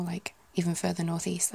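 usual speaking voice

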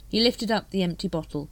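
ordinary delivery

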